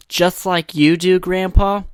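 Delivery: put-on cheeky grandchild; bratty little kid. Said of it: "And then the kid, being a little fucker".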